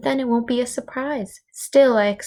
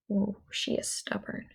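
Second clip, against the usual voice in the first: whisper; 10 dB quieter than usual. Real, not acted. Teacher aside, unvoiced.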